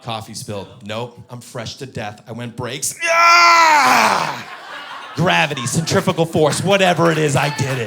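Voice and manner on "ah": sudden high yell of alarm; loud startled scream; yelp. Retold or imitated. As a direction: yelp as the coffee flies. Braking hard in car.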